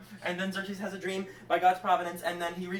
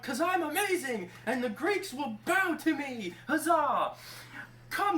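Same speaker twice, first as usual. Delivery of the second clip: pompous king; 9 semitones higher than usual. Acted. King to servants at his feast, pompous. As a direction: proclaim it grandly, lording it over everyone. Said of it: "he was so pompous".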